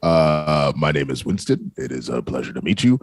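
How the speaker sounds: deep creepy host voice. Pretend. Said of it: "introduce myself with that creepy-ass voice".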